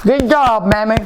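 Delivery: loud, delighted cheer; excited praise. Real, not acted. Praising Mammy's Kitchen, she cheers loudly. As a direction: call it out proudly. Praising the recipe's maker aloud.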